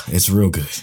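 ordinary talk

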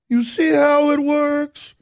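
high, slow, put-on Southern drawl; sweet Southern voice. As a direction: do a mock sugary Southern governor. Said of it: "talking like this in a sweet Southern voice".

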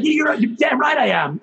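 high, heated comeback; retold angry retort. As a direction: fire back at the coach, voice jumping up. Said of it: "So I lost it on him".

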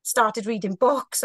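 ordinary talk, nothing marked

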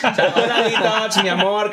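giggling, words slowed by laughter; laughing through words. Real, not acted. Laughing at a friend's Detox joke, real laughter. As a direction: laugh it out while repeating the joke.

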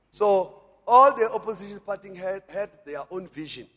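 ordinary delivery

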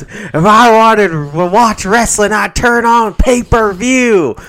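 slow, high, very loud wrestler bellow; ranting wrestler impression. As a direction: bellow it like a pro-wrestling promo. Wrestler-style rant as angry Whitey.

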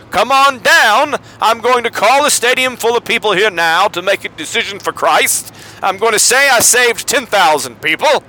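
Billy Graham. Pretend. Preacher calls a stadium to decide, hammed-up evangelist. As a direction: call out the altar call like a stadium preacher. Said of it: "that's my Billy Graham".

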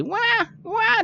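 high-pitched mock baby wail; imitated baby crying. Game master imitating the revived baby, high mock crying. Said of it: "you hear just crying of a baby".

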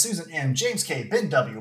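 ordinary talk, nothing marked

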